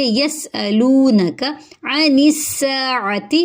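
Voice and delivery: slow recitation, the ع from the throat; tajweed recitation. Shown as a demonstration. Teacher reciting for students, slow. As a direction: give the ع in عن a throaty sound, read slowly. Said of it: "عین حلق سے ادا ہوگا".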